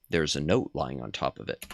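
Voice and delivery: low, soft reading of the on-screen text; game text read. Acted. Streamer reading game text softly to chat. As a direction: read the game's text quietly.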